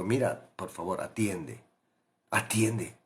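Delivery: quiet confiding plea; hushed urging. Pretend. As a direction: lean in and murmur it earnestly. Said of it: "Algo que te está diciendo".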